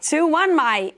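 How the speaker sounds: over-the-top mock Australian accent. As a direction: belt out the score like a cartoon Aussie. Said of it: "do it in your best Australian accent".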